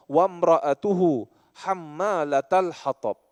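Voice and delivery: slow, measured verse reading; slow Quran recitation. Acted. Preacher reciting the verse to the congregation, slow and solemn.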